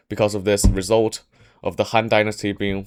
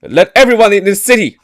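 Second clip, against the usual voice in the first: much higher and louder, rising to a proclamation; loud determined vow; 9 semitones higher, 8 dB louder than usual. Retold or imitated. Cao Cao vows to behead Dong Zhuo, loud and determined. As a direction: declare it like a fearless hero. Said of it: "loud voice".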